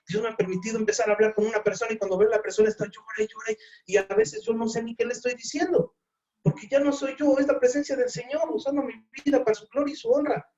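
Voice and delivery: high, rising preacher's fervor; fervent preaching. Real, not acted. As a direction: preach it out with full force. Preaching at full force. (predicación fervorosa, en voz alta)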